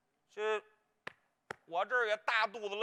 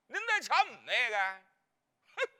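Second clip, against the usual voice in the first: normal talking voice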